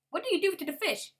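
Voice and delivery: high, loud astonished ask; surprised gasp question. Real, not acted. Player spotting a strange fish, asking in loud astonishment. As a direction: blurt it out amazed.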